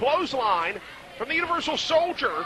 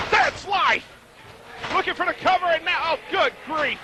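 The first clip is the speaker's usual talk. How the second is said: excited call; 6 semitones higher than usual. Acted. Finisher hit call.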